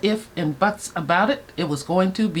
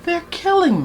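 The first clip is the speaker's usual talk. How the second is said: squealing delight, pitched way up; high excited repeat; 10 semitones higher than usual. Real, not acted. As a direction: gush over the look, much higher. Excited makeup reveal.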